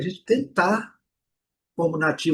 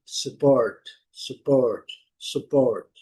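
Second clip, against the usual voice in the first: "support" stressed on "port"; stress on second syllable; 3 semitones lower, much slower than usual. Shown as a demonstration. Teacher demonstrating the verb "support", second syllable strong. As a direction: lean on "port" in "support", slowly. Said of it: "A sílaba forte é a segunda".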